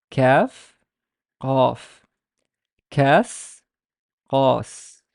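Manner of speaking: "kaf" front then "qaf" backed, "Kas" front then "qas" backed; kaf qaf contrast. Shown as a demonstration. Teacher drills the kaf qaf contrast. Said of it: "compare kaf and qaf".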